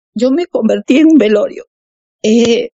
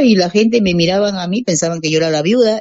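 ordinary delivery

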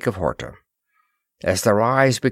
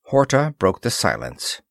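ordinary delivery

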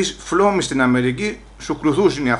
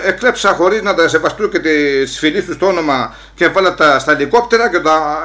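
ordinary talk

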